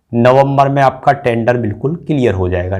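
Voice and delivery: ordinary talk, nothing marked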